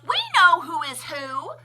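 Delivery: loud, high proud chant; haughty crowd shout. A narrator's reading. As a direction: shout it with snoots in the air. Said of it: "they let out a shout".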